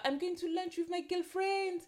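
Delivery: high, breezy French voice; French accent. Retold or imitated. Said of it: "she's like".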